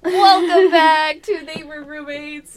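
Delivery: excited intro. Acted. Podcast opening, host intro.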